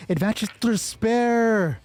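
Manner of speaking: ordinary talk, nothing marked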